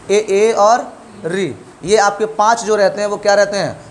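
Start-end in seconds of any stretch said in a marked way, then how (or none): 0.1-1.6 very slow, each vowel stretched; slow listing. Shown as a demonstration. Teacher repeating the front vowel list slowly. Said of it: "तो आप बताओगे".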